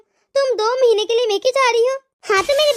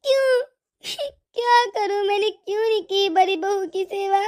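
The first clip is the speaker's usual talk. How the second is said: slow, sobbing lament; acted tearful self-reproach; crying; slower than usual. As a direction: wail with regret, words dragged between sobs. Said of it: "ये माँ जी इतना रो क्यों रही हैं".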